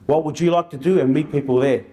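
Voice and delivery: usual speaking voice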